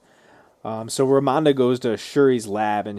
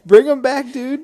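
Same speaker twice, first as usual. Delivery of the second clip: loud, high, laughing cry of delight; excited shout; 14 semitones higher, 9 dB louder than usual. Real, not acted. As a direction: shout it out through a laugh, thrilled. Excited reaction to the character's return.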